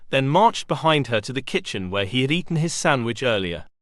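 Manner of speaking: plain reading voice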